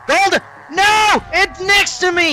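high panicked shouts; panicked yelling. Real, not acted. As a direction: yell in sudden panic. Gold elite appears, panicked yelling.

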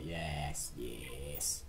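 low, hushed villainous gloat; gloating whisper. Pretend. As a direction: sink to a whisper, relishing the destruction. Gloating over bombing the planet.

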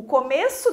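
COMEÇO with a closed E. Shown as a demonstration. Teacher giving a story example, closed E in começo. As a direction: say começo with the tighter closed vowel. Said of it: "a pronúncia mais fechada".

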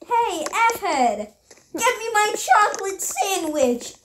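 put-on high, demanding child's voice; bratty little kid. Little Johnny orders his parents about, bratty demand. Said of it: "little Johnny's running up to his parents and going".

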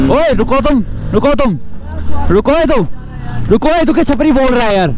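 high, loud yelling at another rider; angry shouting. Real, not acted. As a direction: call out over the helmet to the biker. (Helmet के अंदर से bike वाले पर ऊँची आवाज़ में चिल्लाना)

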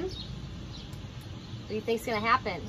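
plain reading voice

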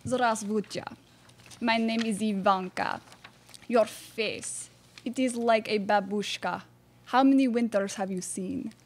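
mock Russian accent. Russian bachelorette Ivanka.

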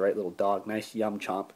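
ordinary delivery

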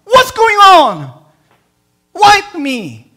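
loud, high cry to God, slowing; anguished cry. Retold or imitated. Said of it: "And he has".

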